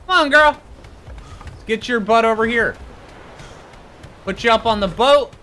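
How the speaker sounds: high-pitched coaxing call; calling a pet. Real, not acted. Player coaxing his bear onto the boat, high coaxing call.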